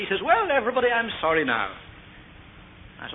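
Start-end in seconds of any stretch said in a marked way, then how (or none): 0.2-1.7 high, bright-voiced little boy's breezy apology; cheerful child. Father imitating his son's cheerful apology. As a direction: chirp it like a carefree four-year-old. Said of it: "He came back very cheerfully".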